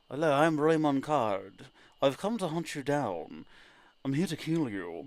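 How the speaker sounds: a menacing bounty hunter intro, lightly voiced; intended villain voice. Shown as a demonstration. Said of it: "Raymond Card was supposed to have a different voice".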